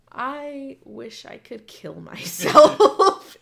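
slow, loud, laughing despair; mortified laughing. Retold or imitated. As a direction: laugh it out in mock anguish. Mortified memory of thinking.

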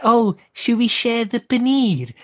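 a hint of a slow, sugary request; lightly voiced polite plea; gently mimicking a guest; mock sweet ask. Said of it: "she's like".

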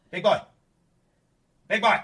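loud high call. Real, not acted. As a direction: call the cat across the room. Calling the cat loudly.

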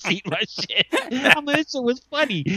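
high-pitched laughing reenactment. Retold or imitated. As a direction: crack up through the words.

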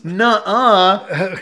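loud, high mock protest; protesting denial. Framed traveler answering his friend's prank, loud protesting denial. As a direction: blurt out an alarmed denial.

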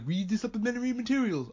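mocking fans. Mocks fans telling him to read.

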